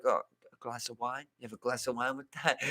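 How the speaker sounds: put-on soft, low Italian-accented suggestion; a light imitation of a charming Italian waiter. Waiter offering wine with the meal, soft Italian accent. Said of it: "all in, like, an Italian accent".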